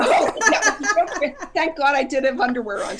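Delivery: loud, high burst of laughter through the words; laughing reply. Real, not acted. Speaker answers a teasing question about transparency, laughing loudly.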